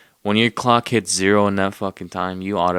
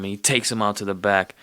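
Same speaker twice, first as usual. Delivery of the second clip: ordinary delivery, nothing marked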